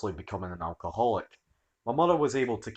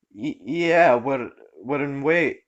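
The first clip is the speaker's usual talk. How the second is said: nervous stammer; 4 semitones higher, 5 dB louder, much slower than usual. Acted. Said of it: "frozen in social anxiety".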